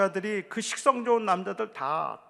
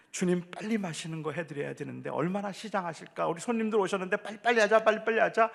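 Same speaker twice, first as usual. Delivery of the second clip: hurried Martha; faster than usual. Acted. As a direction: hurry everyone along in the kitchen. Martha rushing to cook. (바쁘게 서두르는 마르다 말투)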